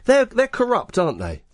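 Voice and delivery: playful high accusation; mock outrage. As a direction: squeal they're corrupt in mock shock. Jokey accusation on radio.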